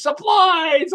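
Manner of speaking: high, loud, stretched mock scream; mocking group chorus. Staff greeting him at business meetings, mock scream. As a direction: imitate coworkers yelling the punchline. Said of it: "they go".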